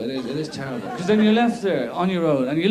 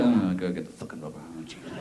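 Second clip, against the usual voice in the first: low, soft muttered grumble; grumbling new guy; 8 dB quieter than usual. Retold or imitated. New worker to himself, low muttered grumble. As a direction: mutter under your breath. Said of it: "You go".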